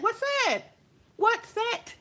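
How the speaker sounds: "What set?" thrown high and sharp; mock pressing "What set?"; mocking challenge. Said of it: "I pulled her fucking card".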